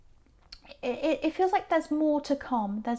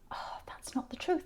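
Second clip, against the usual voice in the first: whispered inner thought; hushed disbelief under the breath; private thought; 4 semitones higher, 7 dB quieter than usual. Retold or imitated. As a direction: breathe it like a thought kept to yourself. Inner thought while watching them.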